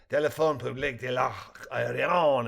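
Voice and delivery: a lightly voiced Frenchman answering the phone; a hint of a French man, calm and formal; French accent, understated; perfect French. A narrator's reading. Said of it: "a man said in perfect French".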